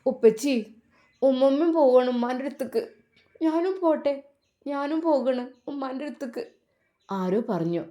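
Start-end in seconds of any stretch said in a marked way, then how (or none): 0.1-6.4 put-on high, small child's voice pleading to go with grandma; little girl's voice. A narrator's reading. Little girl begs her father at the grave, high and plaintive. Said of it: "ആ കുഞ്ഞ് പറയുന്നത് ഇതായിരുന്നു".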